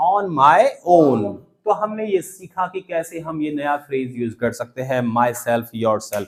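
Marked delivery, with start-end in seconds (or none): 0.1-1.2 very slow, each word apart; teacher to class: slowly for repeating; slow model phrase. Shown as a demonstration. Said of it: "मैं अपनी चाय खुद बना लूंगा".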